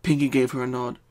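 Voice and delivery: plain reading voice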